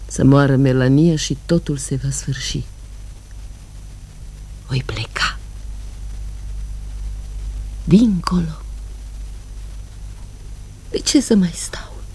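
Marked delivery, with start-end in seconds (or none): 4.7-12.0 breathy hushed whisper, almost to herself. Acted. Woman longing to escape, whispering to herself. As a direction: let the words fall away into a whisper.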